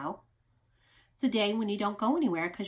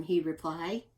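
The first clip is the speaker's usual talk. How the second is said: ordinary delivery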